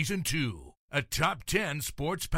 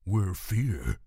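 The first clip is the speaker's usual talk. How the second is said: horror trailer voice; 5 semitones lower than usual. Acted. Podcast intro in trailer voice.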